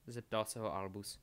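ordinary delivery, nothing marked

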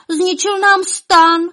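high, slow, loud wail; loud lament. A narrator's reading. Elves mourning their ruined tent, wailing loudly. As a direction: draw the complaint out at high pitch. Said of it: "naříkali tak nahlas".

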